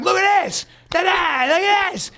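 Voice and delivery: high-pitched re-enacted tantrum; mock frantic outburst. Man smacking himself to show his girlfriend, mock frantic outburst. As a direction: cry it out as a hammed-up display.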